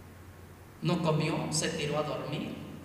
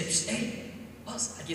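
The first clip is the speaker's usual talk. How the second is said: secret whisper; 4 semitones higher, 4 dB quieter, slower than usual. Acted. Elías calls the servant secretly.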